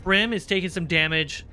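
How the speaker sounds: ordinary talk, nothing marked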